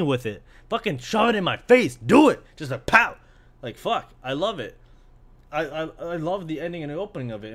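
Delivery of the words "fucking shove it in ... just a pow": loud, high burst of excitement; forceful, fired-up shout; hyped-up demand. Real, not acted. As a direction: punch it out, egging them on.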